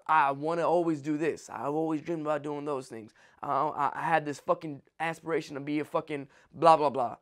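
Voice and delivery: low, soft mocking whine; a mumbled mock of big dreams. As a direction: mimic the dreamer half-heartedly.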